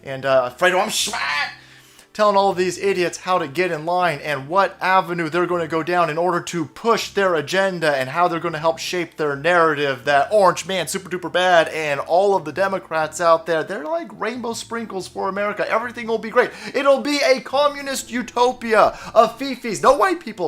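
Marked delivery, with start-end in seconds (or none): 0.8-1.2 high, goofy mock voice; mocking Fredo. 13.6-20.5 high, gushing parody voice; mock utopian gushing. Mocking Democrats' promises.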